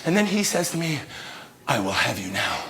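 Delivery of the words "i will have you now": lightly voiced low, soft politician; a hint of a murmured, imperious come-on; Donald Trump. President claims the man in the pile, lightly voiced low murmur. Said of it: "Donald Trump? Is that you".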